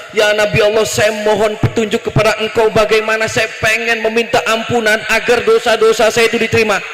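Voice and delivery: ordinary talk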